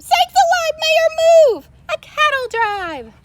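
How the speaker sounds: very high, loud scream of warning; fireman screaming. A narrator's reading. Fireman screaming a warning to the mayor. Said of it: "The fireman screamed".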